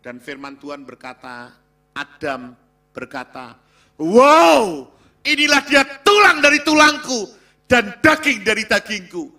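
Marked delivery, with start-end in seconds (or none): 4.0-9.3 Adam's joyful cry, much higher and louder; overflowing joy. Acted. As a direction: burst out with delight at first seeing his wife. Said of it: "Ada sukacita yang meluap dalam diri Adam". (seruan Adam yang meluap penuh sukacita, jauh lebih tinggi dan keras)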